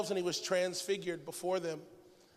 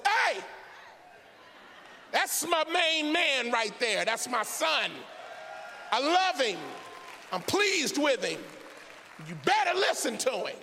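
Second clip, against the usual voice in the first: emphatic God; 5 semitones higher than usual. Acted. God tells disciples about his son, a forceful hollered warning. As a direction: holler it like a bragging, warning father. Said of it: "No, God said".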